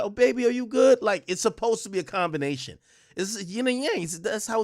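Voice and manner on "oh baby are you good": high, loud doting mom voice. Retold or imitated. Imitating mom comforting her hurt five-year-old, high and doting. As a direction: coo like a worried mother. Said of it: "mommy's supposed to be like".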